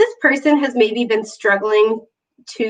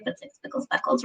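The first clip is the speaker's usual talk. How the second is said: soft breathy whisper on speckle; 11 dB quieter, faster than usual. Real, not acted. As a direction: whisper it like sharing a tiny secret. Pointing out tiny golden speckles.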